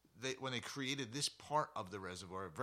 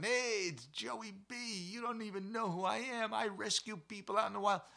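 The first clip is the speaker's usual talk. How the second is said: calm reassuring voice; 10 semitones higher, 4 dB louder than usual. Retold or imitated. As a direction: talk him down gently, calm and friendly. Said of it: "just to keep people calm".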